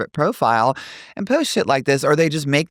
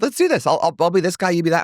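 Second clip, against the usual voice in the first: lightly voiced keen kid, higher pitched; eager daughter, imitated; 6 semitones higher, faster than usual. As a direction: imitate a child pitching a game. Voicing daughter's eager video plan.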